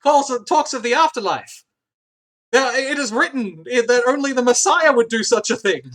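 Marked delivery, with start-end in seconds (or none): 2.5-5.8 lightly voiced zealot, raised and louder; prophesying droid. Droid reciting belief in the Messiah, mock proclamation. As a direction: proclaim it like scripture.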